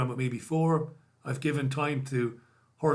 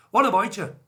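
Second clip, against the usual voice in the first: higher, louder mock Belfast greeting; hammed-up Belfast accent; 6 semitones higher, 6 dB louder than usual. Host repeats the greeting for Andrew in an exaggerated, louder Belfast accent. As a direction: belt out a bad Belfast accent. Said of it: "Worst accent of all time".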